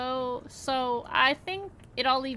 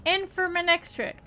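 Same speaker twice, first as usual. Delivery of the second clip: put-on folksy country accent; 5 dB louder than usual. [name] defends himself to the builders in a light folksy country accent. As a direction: grumble it like an old country rancher. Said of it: "I just had an accent all of a sudden".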